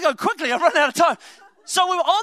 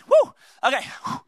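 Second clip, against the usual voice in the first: ordinary talk, nothing marked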